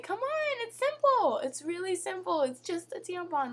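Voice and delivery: like, come on said high in amused exasperation; exasperated, still laughing. Real, not acted. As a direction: throw it out high, half laughing.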